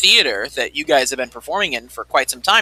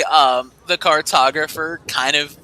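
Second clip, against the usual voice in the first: usual speaking voice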